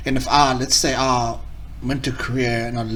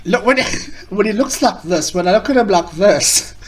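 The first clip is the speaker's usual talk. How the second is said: laughing while talking, higher and louder; amused; 6 semitones higher, 4 dB louder than usual. Real, not acted. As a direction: burst out laughing mid-sentence. Cracking up at the man's look.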